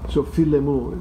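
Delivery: so file mu, file loud and falling; so file mu, hit file; loud clear demo. Shown as a demonstration. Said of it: "the accent is on the I".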